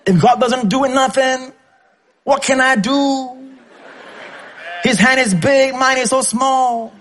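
high, slowed, pleading voice of a fretting believer; helpless worrier. Retold or imitated. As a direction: whine like someone who feels tiny before God. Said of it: "That's your attitude".

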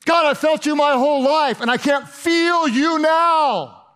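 high, anguished cry stretched at the end; broken, desperate cry. Acted. Jesus crying to God in his brokenness, desperate. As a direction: wail it upward, holding the last words long. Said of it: "in his greatest moment of brokenness".

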